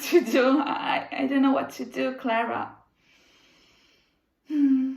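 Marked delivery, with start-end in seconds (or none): none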